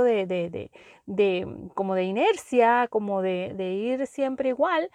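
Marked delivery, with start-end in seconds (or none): none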